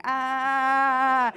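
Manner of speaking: held, high mock scream; screaming back. Comic answers the screaming woman, mock scream. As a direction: scream back on one long note. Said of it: "we're just screaming".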